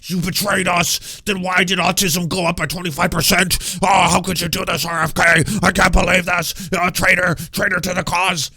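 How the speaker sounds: shrill-voiced parody of a furious anti-vaxxer; mocking Ian Carroll. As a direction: ham up a betrayed conspiracy fan yelling. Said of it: "And he was like".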